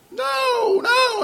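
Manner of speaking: very high, loud screech of no; child screeching no. Retold or imitated. Little boy refusing his father, high screech. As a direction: shriek it like a defiant toddler. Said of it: "just screeching and screeching".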